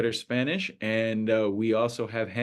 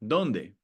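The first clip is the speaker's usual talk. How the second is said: "Donde" with DON stressed, then falling. Shown as a demonstration. Teacher demonstrating an accent mark, first-syllable stress on "Donde". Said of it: "On the O".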